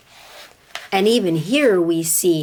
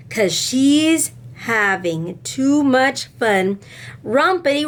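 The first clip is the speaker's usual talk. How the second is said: put-on expressive read-aloud to children; lively classroom storytime voice; storybook reading; 5 semitones higher than usual. A narrator's reading. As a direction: tell it like a picture book to kids. Reads a picture book aloud to class.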